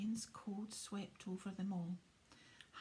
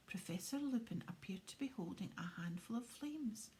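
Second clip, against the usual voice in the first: ordinary delivery, nothing marked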